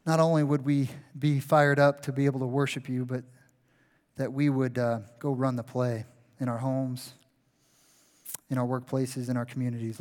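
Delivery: soft, low closing prayer. Real, not acted. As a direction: pray quietly over the congregation.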